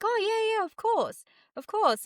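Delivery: acting out a keen, high-pitched yes; eager agreement. Keen young employee agreeing to the director's question, eager. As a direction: imitate an eager junior, pitch starting very high.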